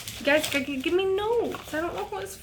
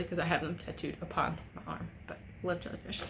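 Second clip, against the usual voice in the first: whispered aside; 6 semitones lower, 10 dB quieter, faster than usual. Real, not acted.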